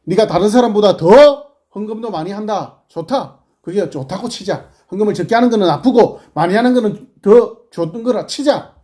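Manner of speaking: high, loud rebuke to the congregation; fiery preaching. Acted. Preacher scolds the congregation in a loud, high voice. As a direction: hammer each line like a prophet.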